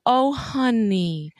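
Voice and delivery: slow, drawn-out mock sympathy; sarcastic slow coo; mock consoling. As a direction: stretch out a patronizing "oh honey". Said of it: "it's like".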